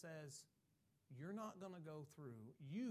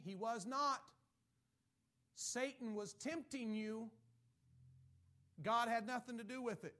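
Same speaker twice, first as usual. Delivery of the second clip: loud, forceful rebuttal; full-force pulpit declaration; preaching loud; 5 semitones higher, 7 dB louder than usual. Real, not acted. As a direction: hammer it home to the congregation.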